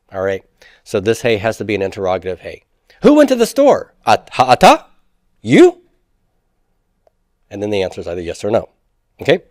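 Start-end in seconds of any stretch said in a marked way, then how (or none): 3.0-5.8 Who went to the store, ha'ata, You? pitched high and loud; rising question tune. Shown as a demonstration. Teacher acting out a question to the class, high rising. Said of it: "raising the intonation".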